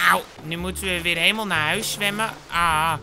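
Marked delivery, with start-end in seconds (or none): none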